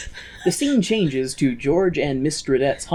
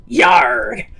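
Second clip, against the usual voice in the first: pirate; 7 semitones higher, 7 dB louder than usual. Pretend. Pirate art joke.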